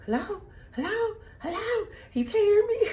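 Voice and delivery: very high, rising, laughing squeal; mocking the other caller, laughing; squeaky mocking hello. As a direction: shriek it up into a cartoon squeak.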